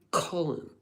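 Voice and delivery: light posh English Colin. Retold or imitated. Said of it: "I love how they say it in England, like, posh English".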